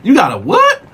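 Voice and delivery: high, startled huh, then an incredulous question; shocked disbelief. Retold or imitated. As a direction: jump way up in pitch, caught off guard. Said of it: "his whole demeanor changed".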